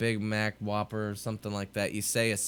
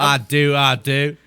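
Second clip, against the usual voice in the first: loud, higher mock British accent; 5 semitones higher, 10 dB louder, slower than usual. Co-host obliging a request for British, mock accent. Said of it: "Do the British accent".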